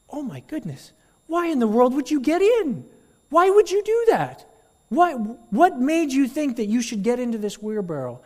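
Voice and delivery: very high, loud incredulous questions; astonished reporters. Acted. Reporters quiz the boy, high astonished. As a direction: pester him in disbelief. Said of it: "And they go".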